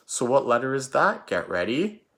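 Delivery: ordinary talk, nothing marked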